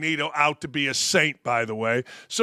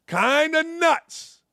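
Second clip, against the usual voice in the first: mocking drawl; 9 semitones higher, much slower than usual. Mocking the media's take.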